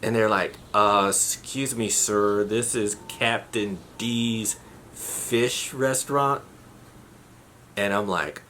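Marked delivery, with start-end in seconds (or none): 0.7-6.4 slow, deliberate excuse me, sir; a mildly patient receptionist, taking it slow; slow patient explanation. Retold or imitated. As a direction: spell it out slowly for a confused caller. Restaurant worker explains slowly.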